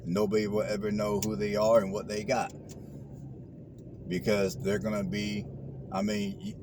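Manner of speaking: ordinary talk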